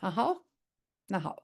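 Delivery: ordinary delivery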